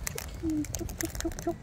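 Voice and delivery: soft, high breathy clucks; soft clucking call. Real, not acted. Owner clucking softly to her bird.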